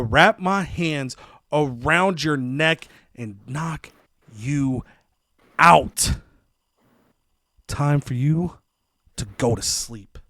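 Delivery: slow threat broken by long pauses; slow menacing threat. Retold or imitated. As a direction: deliver a wrestler's threat word by word. Acted wrestler threatens his opponent.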